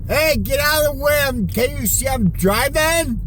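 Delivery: loud, high New York driver yelling; angry New York cabbie shout; New York accent. Pretend. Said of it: "I like my New York accent better".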